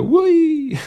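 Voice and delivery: a joyful squeal while flying through the air; gleeful whee. Retold or imitated. Narrator voicing Sheena flying off the stage, gleeful high squeal.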